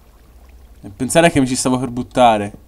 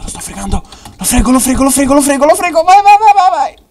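excited, building; 8 dB louder than usual. Real, not acted.

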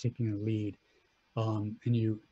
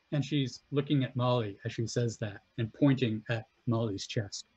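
ordinary delivery

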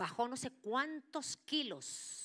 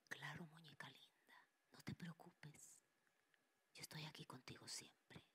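false father spirit whispering; spirit's soothing whisper; 3 semitones lower, 15 dB quieter than usual. Retold or imitated. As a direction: whisper like a spirit calming his daughter.

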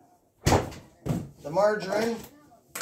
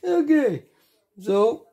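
high silly voice; 8 semitones higher, 4 dB louder, much slower than usual. Real, not acted. Still in high voice.

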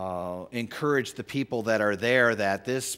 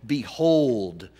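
mock biblical call; 5 dB louder than usual. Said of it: "when writers say things".

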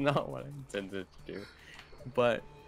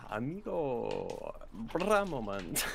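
slow, drawn-out fake Spanish; lightly put-on Spanish accent; mock Spanish accent; much slower than usual. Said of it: "the Spanish bruh".

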